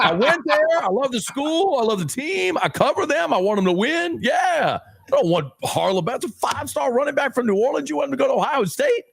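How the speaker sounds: high, fired-up declaration; passionate outburst. Real, not acted. LSU fan telling V K why Harlem matters, high and fired up. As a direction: proclaim your loyalty, voice up high. Defending his school passionately.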